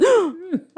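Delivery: sharp retold gasp of shock; hard gasp. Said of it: "the hardest gasp".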